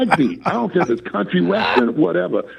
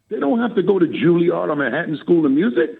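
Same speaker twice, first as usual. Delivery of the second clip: usual speaking voice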